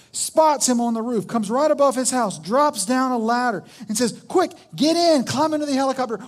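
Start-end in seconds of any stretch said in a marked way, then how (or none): 4.3-6.2 higher, pressing rescuer's shout; urgent call. Acted. Helicopter crew urging a man on a roof, urgent call. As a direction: hurry him aboard.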